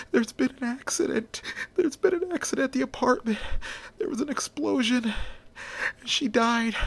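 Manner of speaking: a man barely getting the words out through sobs; crying. A narrator's reading. Jack telling Donnie and Roy that Linda died, crying. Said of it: "and he's crying".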